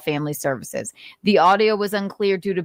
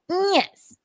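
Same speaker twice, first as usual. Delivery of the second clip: Nick impression; 12 semitones higher than usual. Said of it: "Nick's impression".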